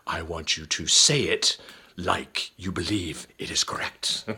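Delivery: Shakespearean gravity, weighing each phrase, dropping to a near whisper; Mister Callis. Retold or imitated. English teacher correcting his spelling pupil up close, very serious. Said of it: "that very serious".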